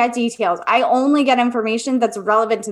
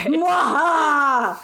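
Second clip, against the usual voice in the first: evil cackle; 5 semitones higher, much slower than usual. Pretend. Joking about conjuring spirits.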